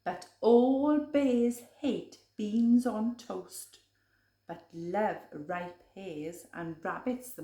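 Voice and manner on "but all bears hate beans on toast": ordinary delivery, nothing marked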